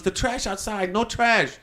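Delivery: high, restrained mock yell; retold high-pitched pleading; mock screaming. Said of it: "he's just screaming".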